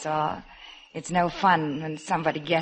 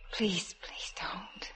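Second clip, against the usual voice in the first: slow, breathless whispered plea; slow hushed protest; pleading whisper; 11 dB quieter, much slower than usual. Acted. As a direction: barely voice it, melting. Resisting a kiss, intimate.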